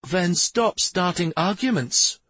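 high, drawn-out cross retort; snapping. A narrator's reading. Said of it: "Shari snapped".

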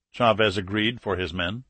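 plain reading voice

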